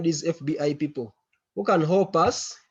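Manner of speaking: ordinary talk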